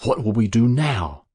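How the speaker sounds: a touch of troubled excitement; troubled and excited. A narrator's reading. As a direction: ask it worried and keyed up. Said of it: "troubled and excited".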